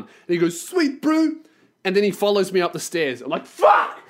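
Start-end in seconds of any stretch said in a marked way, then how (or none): 0.6-1.3 high-pitched mock Kiwi bro; wasted Kiwi guy. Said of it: "I walk up to the Kiwi guy". 3.5-4.0 loud, unpitched burst of frustration; exasperated outburst. Retold or imitated. Reacting to Kiwi following him upstairs.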